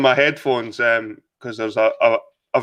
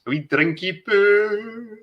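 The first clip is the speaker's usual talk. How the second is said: put-on higher, slow, babyish drinky poo; cutesy; 8 semitones higher, slower than usual. Streamer to viewers about his drink, playful cutesy coo.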